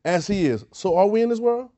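"so are we in this world?" lifted high, rising through WORLD; asked as a question. Shown as a demonstration. Preacher telling listeners to ask themselves, "in this world?" rising questioningly. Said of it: "I'm gonna say there's a question".

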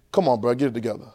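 low, soft, laid-back coaxing; God nudging like a buddy; God urging the preacher, low and soft; casual God voice. Acted. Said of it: "That's how he said it".

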